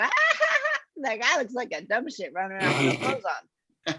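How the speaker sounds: laughing scornfully, pitched high at the start; mocking laugh. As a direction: laugh at him as you say it. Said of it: "the audience going".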